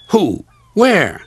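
high, eager questions; surprised. Acted. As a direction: blurt it out in surprise. Thomas surprised, asking eagerly.

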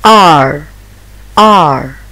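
ar said low and loud; ar sound. Shown as a demonstration. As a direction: growl out a long ar. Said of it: "The sound of A R".

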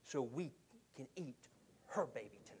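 acted plea fading very soft and low; hushed comic reveal. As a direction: drop away into a near whisper. Woman's grisly plea to king.

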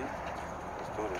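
slow breathy whisper. Real, not acted. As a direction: whisper it, bracing yourself. Nervous psyching up.

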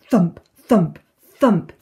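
punched, separate loud thumps; drum thumps. Acted. Eleanor hitting the tin, voiced as heavy thumps. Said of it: "goes the tin".

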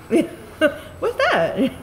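high, amused imitation of a puzzled listener; Bugs. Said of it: "Bugs will come over and he's like".

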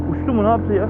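ordinary talk, nothing marked

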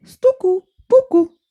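woodpecker knocking. A narrator's reading. Woodpecker answering with knocks, loud. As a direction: tap it out like a woodpecker. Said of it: "Na to dzięcioł".

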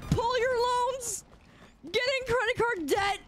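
over-the-top betrayed whining at an unfollower; mock outrage. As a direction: whine it high like a jilted lover. Ranting at unfollower.

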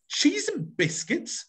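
high, slow, scandalised question; appalled at the cost; outraged. Shown as a demonstration. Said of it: "Really outrageous".

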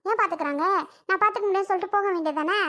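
ordinary delivery

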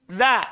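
Da said with the tongue tip on the upper front teeth; Da, tongue tip to upper teeth, not za; Da as a firm d, not za; clear da sound. Shown as a demonstration. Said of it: "Da itu ujung lidah".

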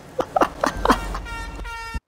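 very high cackling laugh, trailing off; hysterical laugh. Real, not acted. Gamer cracking up at a big hit, high cackle.

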